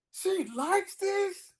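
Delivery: high, slow disbelieving question; can't believe she likes this; stretched-out high disbelief; incredulous. Retold or imitated. Disbelief at woman enjoying music.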